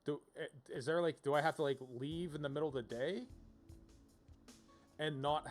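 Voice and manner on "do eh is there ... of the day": ordinary talk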